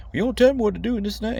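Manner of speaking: mock screaming guy. Vlogger mimicking the guy who won't back down, high fast mock yell. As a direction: rattle it off like a nagging hothead, hammed-up. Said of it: "like, screaming at you".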